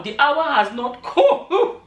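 slow, stretched words collapsing into real laughter; laughing while reading. Real, not acted. Vlogger reading a mocking comment to viewers, dissolving into laughter. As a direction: break up laughing before the last word is out.

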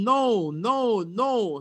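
repeated anguished "no", fading; pleading "no, no, no" in despair; crying out. A narrator's reading. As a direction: cry out then trail off. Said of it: "cried Graham".

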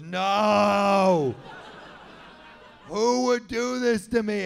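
hammed-up despair over a wrecked BMW; anguished wail. Stu on knees, car vandalized.

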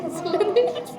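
giggling through the word; laughing. Real, not acted. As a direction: laugh while saying it. Laughing mid-word while filming. (gülerek)

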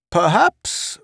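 high-pitched solemn character voice. A narrator's reading. Murchison weighing in on Pace's attitude, solemn. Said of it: "Murchison said solemnly".

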